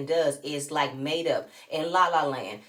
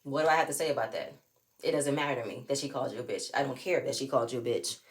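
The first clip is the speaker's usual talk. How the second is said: dismissive husband; 5 semitones lower, 4 dB quieter, faster than usual. Retold or imitated. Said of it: "he feels like".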